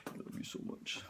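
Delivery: soft whispered affection to a pet; hushed, private cooing; doting whisper. Real, not acted. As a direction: whisper it lovingly to your pet.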